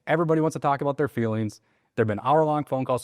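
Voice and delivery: high, emphatic, relieved sales pitch; loud, assertive. Shown as a demonstration. Agent winning over an impatient seller on the phone, assertive. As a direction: say it with your chest, assertively glad. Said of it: "I'm talking loud too into the phone, like, assertive".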